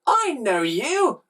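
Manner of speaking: high, slow drawn-out character voice; dawning recognition. A narrator's reading. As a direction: stretch it out as if recognising someone. Villain seems to recognise boy.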